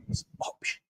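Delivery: whispered like a conspiratorial aside; mock secret whisper. Host sneaking in a drink brand, mock secret whisper. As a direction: whisper the brand name as if it's contraband. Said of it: "I'm not supposed to advertise it".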